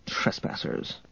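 whispered, bitter mutter; angry mutter. A narrator's reading. Han seeing the enemy fleet, angry whispered mutter. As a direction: mutter it angrily under your breath. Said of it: "then muttered angrily".

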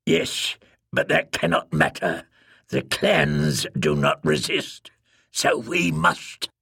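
low, slow, grim insistence; bitter resolve. Acted. Bitter speaker insists the exiles must resist, low and slow. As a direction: drop low and weigh each word slowly.

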